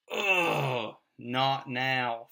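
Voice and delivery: loud groaning "Ugh", then a tight "not now"; exasperated groan. Retold or imitated. Parent's inner groan when the kids burst in, exasperated. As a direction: let the frustration out on "Ugh" before "not now". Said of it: "that familiar chest-tightening".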